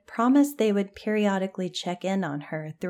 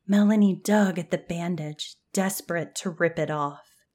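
plain reading voice

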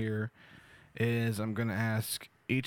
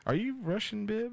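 usual speaking voice

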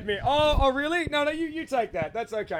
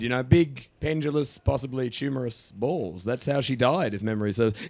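much lower, rich radio voice; deep announcer voice; 10 semitones lower than usual. Pretend. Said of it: "how my voice changes the minute a microphone's given".

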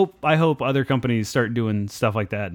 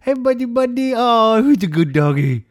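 talking to a dog; 10 semitones higher, 3 dB louder than usual. Real, not acted. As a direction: coo at the dog, much higher. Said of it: "But his dog".

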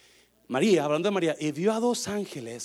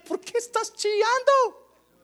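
high, loud, drawn-out question; insistent calling out; 9 dB louder, slower than usual. Acted. Jesus beside weeping Mary asking why, insistent call. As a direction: cry it out at a high pitch.

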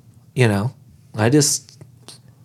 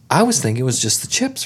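ordinary delivery

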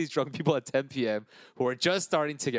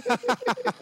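high burst of laughter; 4 dB louder than usual. Real, not acted. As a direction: squeal of laughter. Laughing at the prank story.

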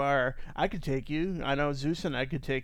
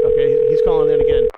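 loud announcing; 15 dB louder than usual. Acted. Host announces caller, loud.

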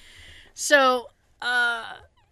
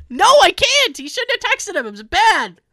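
indignant insist; 10 semitones higher, 6 dB louder than usual. Retold or imitated. Her insistent reply.

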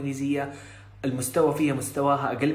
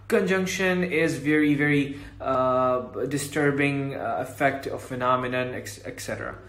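slow mock lecture; much slower than usual. Mock hard English podcast.

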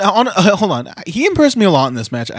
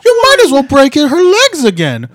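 high, loud mock wail; Dakota Kai; 11 semitones higher, 5 dB louder than usual. Co-host badly imitates Dakota Kai, loud high wail. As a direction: cry it out in a hammed-up high voice. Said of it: "that's not what Dakota Kai sounds like".

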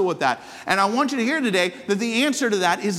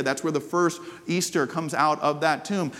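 ordinary talk

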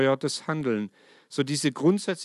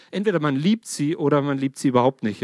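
usual speaking voice

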